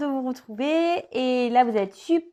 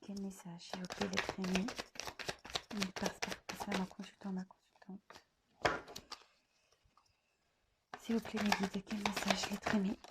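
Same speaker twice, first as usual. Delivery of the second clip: much softer murmured prayer, sliding into a whisper; whispered invocation; 11 dB quieter than usual. Acted. Tarot reader pleading with her guides while shuffling, hushed whisper. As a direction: breathe the question to your guides.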